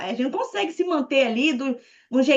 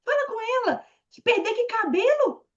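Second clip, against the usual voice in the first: high, playful mischievous whisper-free urge; teasing inner voice; 11 semitones higher than usual. Retold or imitated. Her inner voice urging her to mock the director, high and cheeky. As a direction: egg yourself on like a cheeky little devil. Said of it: "minha mente falava".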